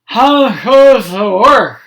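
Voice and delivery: slow loud farmer call. A narrator's reading. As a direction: drag out the call like a woken farmer. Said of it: "he called".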